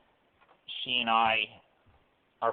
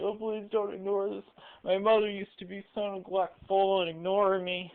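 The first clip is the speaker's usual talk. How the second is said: high, whining put-on voice of a pitiful man; mock whiny caller; 9 semitones higher than usual. The caller begging Stu not to ignore him, in a high mock whine. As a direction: whimper it like a wounded victim, hamming it up. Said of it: "later in the scene when he's like".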